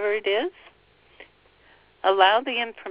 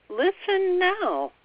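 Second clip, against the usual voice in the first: Listen now stretched slow and lifted higher; second listen now, slow and raised; drawn-out higher call; 9 semitones higher, much slower than usual. Acted. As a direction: call them in, slowly and higher. Meditation guide repeats a call.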